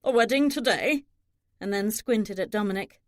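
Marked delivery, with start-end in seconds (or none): 0.1-1.1 higher-pitched, mildly demanding question; a touch of indignant demand. A narrator's reading. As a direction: demand an answer, pitch lifted. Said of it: "Thomas demanded".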